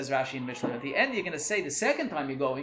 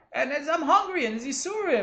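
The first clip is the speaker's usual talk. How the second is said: 1 high whining complaint; 11 semitones higher, 4 dB louder than usual. Retold or imitated. Voicing hungry complaining learner.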